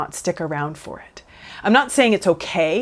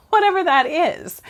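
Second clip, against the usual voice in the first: laughing, much higher; 10 semitones higher than usual. Real, not acted. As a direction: laugh the words off lightly. Laughs at her own example.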